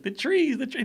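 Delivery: high-pitched mock exclamation; excited driver impression. Driver who watches the podcast teasing host, excited impression.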